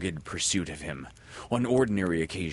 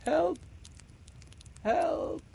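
high, thin cries for help; feeble cry for help. A narrator's reading. Stranded children crying out faintly for help. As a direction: call weakly from the rock. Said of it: "a feeble cry".

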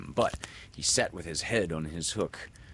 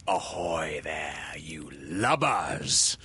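slow, low, rasped pirate call; imitating Hook; 3 semitones lower, much slower than usual. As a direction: Peter faking the captain's menace. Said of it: "he imitated the voice of Hook".

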